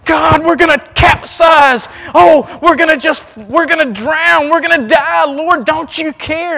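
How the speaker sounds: high, loud frantic cry of terror; panicked disciples. Acted. Disciples yelling over the storm in panic. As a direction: shout it like you're about to drown.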